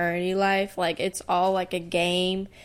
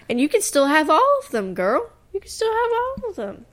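sassy; 9 semitones higher than usual. Pretend. Mock catty reply to girls.

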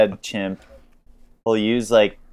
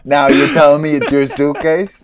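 louder tough-guy gangster voice; tough guy chimp; 4 semitones higher, 6 dB louder than usual. Retold or imitated. Papa chimp brushing off the pig, louder tough-guy voice. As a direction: play the swaggering mob boss. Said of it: "papa fucking chimpanzee is like".

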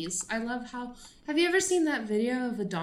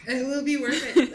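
laughing it out, higher and louder; laughing agreement; 6 semitones higher, 7 dB louder than usual. Real, not acted. Agreeing with a friend's joke, laughing hard. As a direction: agree while cracking up.

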